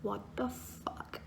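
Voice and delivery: breathy whispered what the fuck; whispered disbelief. Retold or imitated. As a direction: mutter it under your breath in shock. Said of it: "And I was like".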